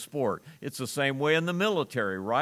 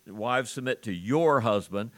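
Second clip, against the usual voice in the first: your hit clearly, wives submit to your husband; stress on YOUR. Shown as a demonstration. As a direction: lean on the word your. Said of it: "I would emphasize the word your".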